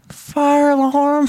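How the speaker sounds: put-on high, slow child voice; sheepish little daughter. Daughter reading sign for dad, small drawn-out voice. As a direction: imitate a child reluctantly reading aloud.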